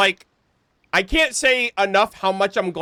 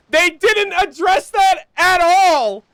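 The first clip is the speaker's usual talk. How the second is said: indignant; 9 semitones higher, 5 dB louder than usual. Real, not acted. Streamer outraged at game.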